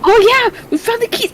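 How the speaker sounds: high excited remembering; suddenly remember, thrilled. Acted. Excited realisation.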